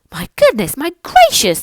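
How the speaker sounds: a touch of awed shouting; amazed shout. Acted. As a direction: gasp in wonder. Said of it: "they shouted".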